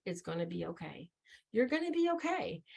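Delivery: soft, gentle comfort for a friend; a gentle, retold reassurance; reassuring. Telling a struggling friend it will be fine, softly reassuring. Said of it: "that's how I say it".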